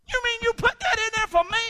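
high and loud, suddenly amazed; amazed question. Retold or imitated. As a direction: cry out in happy disbelief. His surprised reply to God.

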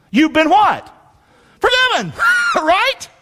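high-pitched shout-out breaking into a laugh; excited prodding. Real, not acted. Preacher quizzing congregation on forgiveness, excited and laughing. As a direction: pitch leaps as you demand the answer.

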